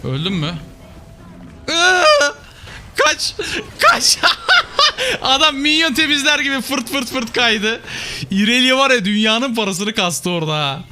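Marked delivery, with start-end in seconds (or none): 1.7-2.3 sudden high shriek, loud; startled high squeal; panicked shriek. Real, not acted. Enemy jumps on him mid-fight. 2.9-4.2 high, loud yells of "Kaç!"; frantic shouts to run; panicked shouting. Real, not acted. Urging teammate to run away. 4.2-5.2 high laugh. Real, not acted. Laughs after the escape. 5.2-10.8 excited. Real, not acted. Excited recap after the fight.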